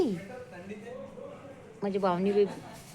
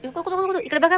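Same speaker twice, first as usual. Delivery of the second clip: very high, loud coaxing call; 8 semitones higher, 10 dB louder than usual. Real, not acted. Owner squealing baby talk to get her dog's attention. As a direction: squeal at the dog to look here.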